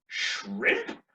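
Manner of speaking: very high-pitched, questioning squeak; puzzled server. Retold or imitated. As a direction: query it as a baffled server, way up high. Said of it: "And he said".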